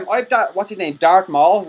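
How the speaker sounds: usual speaking voice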